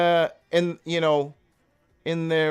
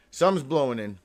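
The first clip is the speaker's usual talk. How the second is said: ordinary talk, nothing marked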